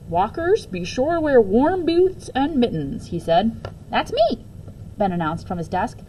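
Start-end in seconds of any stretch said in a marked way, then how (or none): 0.1-3.1 slow, high principal's voice; principal announcing. A narrator's reading. Principal addressing walkers. 3.9-4.4 put-on much higher eager child. A narrator's reading. Child voice in story.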